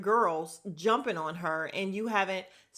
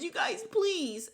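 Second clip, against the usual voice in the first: mocking whiny plea; 10 semitones higher, slower than usual. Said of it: "all you could say was".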